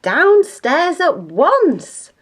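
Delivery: high, loud, slow mother's bellow. A narrator's reading. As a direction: bellow each word as an exasperated order. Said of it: "bellowed Missus Large".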